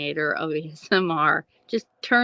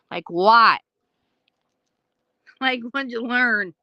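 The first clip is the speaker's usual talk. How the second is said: skeptical; 4 semitones higher, 4 dB louder than usual. Retold or imitated. Quoting herself to brother.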